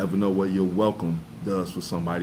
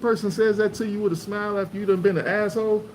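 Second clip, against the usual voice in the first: comedienne voice; 12 semitones higher than usual. Retold or imitated. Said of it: "she said".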